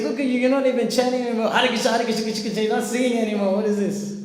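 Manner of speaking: loud, high, rapid-fire rant; hammed-up ranting at a singer; imitated heckling. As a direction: shout it fast in mock outrage. Heckler mocks the panicked chanting.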